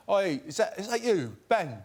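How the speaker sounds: a playful imitation of a British man calling out; British accent. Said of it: "That's my British accent".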